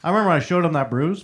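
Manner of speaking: ordinary talk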